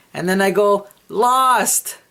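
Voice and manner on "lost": high, loud, giggly "Lost."; laughing "Lost". Retold or imitated. Dreamer answers the doctor with a giggling "Lost.". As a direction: blurt "Lost." through a laugh.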